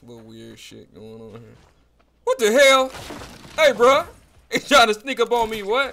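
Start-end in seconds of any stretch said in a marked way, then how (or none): 2.2-5.9 loud, high-pitched alarm; startled outburst. Real, not acted. Gamer caught by someone sneaking up, startled. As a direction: exclaim loudly in disbelief.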